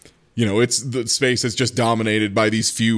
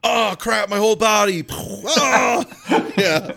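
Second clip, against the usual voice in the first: mock pain; 9 semitones higher than usual. Mocking burned man's pain.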